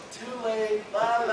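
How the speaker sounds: high playground teasing; taunting. Acted. Zombie child taunting the one choking her, teasing. Lenore taunts while being choked.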